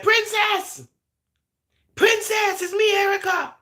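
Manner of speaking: high, loud call, imitating Erica; calling out to Princess. Erica calling Princess on the phone, high loud call. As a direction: call her name up high across the line.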